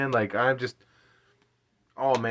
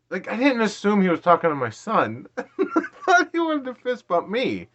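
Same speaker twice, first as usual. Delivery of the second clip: high, incredulous self-mockery broken by laughter; laughing in disbelief; 8 semitones higher than usual. Real, not acted. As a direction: laugh at your own mistake, pitch climbing. Laughs at his own mistaken assumption.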